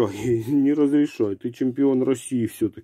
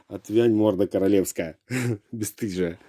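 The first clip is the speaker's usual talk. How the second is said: ordinary talk, nothing marked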